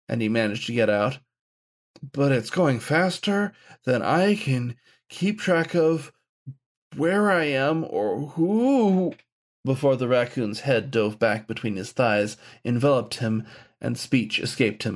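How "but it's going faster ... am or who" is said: higher, slow, breathless and dazed; lost and flustered, slowly; overwhelmed young man. A narrator's reading. Gawain overwhelmed, gasping.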